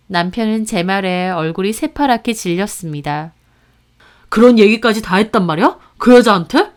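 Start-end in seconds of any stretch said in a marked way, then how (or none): 4.3-6.7 rising, louder, shocked accusation; alarmed anger. Acted. Husband reacting to his wife's revelation, loud and alarmed. Husband confronted about ex-wife.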